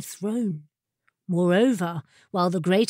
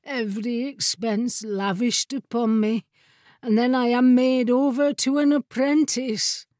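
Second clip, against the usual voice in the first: gloomy, aggrieved lament; mournful; 3 semitones higher than usual. A narrator's reading. Wizard's chair bemoans being handed down, mournful and aggrieved. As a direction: build a little from woe to grievance. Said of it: "the mournful voice continued".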